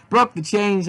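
ordinary delivery, nothing marked

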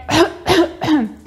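a short forced cough; demo cough. Shown as a demonstration. Teacher coughs to demonstrate coughing. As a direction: cough once to show the verb. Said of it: "this verb is called to cough".

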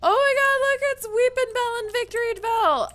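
high, loud fangirl squeal; excited. Real, not acted. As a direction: burst out shrieking with delight. Spotting favourite Pokemon.

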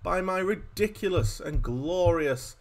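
ordinary talk, nothing marked

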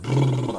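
short high-pitched buzz; imitated brrr. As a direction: mimic someone buzzing a brrr in denial. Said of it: "No, I never did that".

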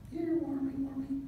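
high coaxing call, soft; God coaxing a little worm over, high and sweet. Pretend.

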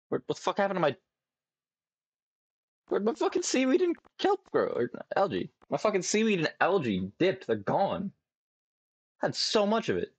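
very high shocked whine. Real, not acted. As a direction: shriek in disbelief. Crops suddenly gone.

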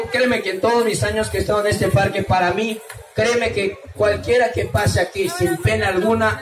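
usual speaking voice

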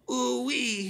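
high goofy ooh-wee; Poopy Butthole catchphrase. Acted. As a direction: squeal the catchphrase. Catchphrase, he says.

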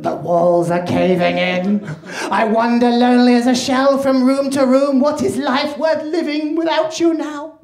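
loud, melodramatic despairing wail; hammed-up theatrical misery; mock depression. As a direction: wail slowly, climbing in pitch. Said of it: "The fourth one's depression".

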